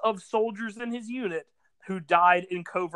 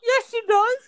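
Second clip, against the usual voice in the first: squeaky insistent; 6 dB louder than usual. Real, not acted. Playful insistent high retort.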